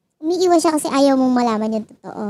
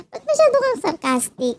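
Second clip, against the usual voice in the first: teasing; 9 semitones higher than usual. Acted. Teasing the viewer.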